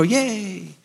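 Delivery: quiet, mock little cheer; a limp, sarcastic yay from the crowd; airy mock cheer. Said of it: "we all go".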